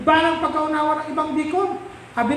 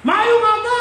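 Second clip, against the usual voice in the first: high carrying Ilonggo greeting; high hollered hello; calling out; 10 semitones higher, 4 dB louder than usual. Retold or imitated. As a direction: hail the pastor from a distance. Repeats the called greeting.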